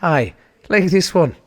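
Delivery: put-on loud, slow Irish accent. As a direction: answer in a broad Irish accent. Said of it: "Amazon's Irish for some fucking reason".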